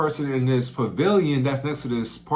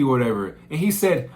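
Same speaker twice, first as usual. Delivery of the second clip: ordinary talk, nothing marked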